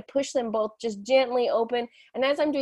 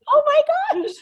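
much higher, loud exasperation; exasperated outburst; 6 dB louder, faster than usual. Real, not acted. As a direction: throw up your hands, oh my gosh. Exasperation at kids.

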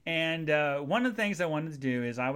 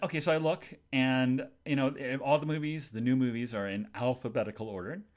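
ordinary talk, nothing marked

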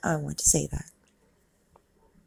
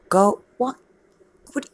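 ordinary talk

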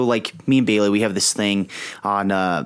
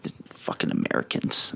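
muttered complaint; 8 dB quieter than usual. Retold or imitated. Foreign visitors grumbling about Americans.

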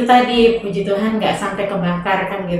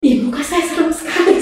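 higher-pitched shocked "ih" at oneself; startled exclamation; 6 semitones higher, faster than usual. Retold or imitated. Survivor reacting to a tabloid photo of their face, startled. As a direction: recoil in mild horror, voice pitched up. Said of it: "Saya sampai gini".

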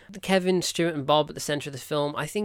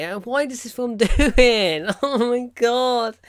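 despairing groan; 8 semitones higher, 6 dB louder, slower than usual. Real, not acted. Despairing at the film's stereotypes.

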